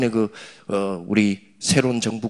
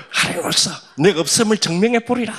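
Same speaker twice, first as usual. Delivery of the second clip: higher, louder haughty boast; mocking atheist; 9 semitones higher, 6 dB louder than usual. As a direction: ham up a smug atheist. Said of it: "이러면서". (잘난 척하는 무신론자 흉내, 높고 크게)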